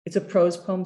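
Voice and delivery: normal talking voice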